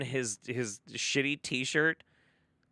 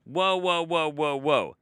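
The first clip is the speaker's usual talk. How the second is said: loud whoa, higher; halting interruption; 4 semitones higher, 7 dB louder than usual. Real, not acted. Streamer stopping the video loudly to chat.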